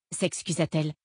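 ordinary delivery, nothing marked

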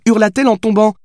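high character voice, pointedly reading aloud; Billy quoting the book. A narrator's reading. Billy reads the line to the writer, high and insistent. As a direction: read it out high, as proof.

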